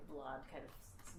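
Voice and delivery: ordinary talk, nothing marked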